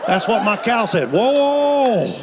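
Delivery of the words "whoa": startled whoa. Retold or imitated. Cow hooked in the lip.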